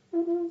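high buzzing like a bee. Retold or imitated. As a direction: buzz like a bee. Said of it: "A bad impression of a bee".

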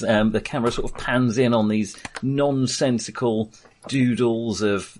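usual speaking voice

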